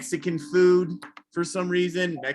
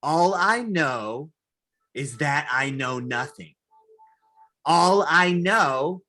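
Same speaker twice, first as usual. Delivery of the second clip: mocking the ex; slower than usual. Comic mocking his spiritual ex in a slow, lofty voice. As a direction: parody her pretentious wisdom. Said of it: "she would always say shit like".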